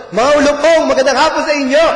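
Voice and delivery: high, loud, fast joking sermon opener; mock preacher greeting. Preacher imagining John's Tagalog greeting to the crowd, high and laughing. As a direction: greet the congregation as vipers with a grin. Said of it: "Sa Tagalog".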